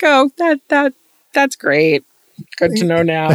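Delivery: high, loud dry disbelief; deadpan stunned reply; sarcastic. Retold or imitated. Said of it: "I was like".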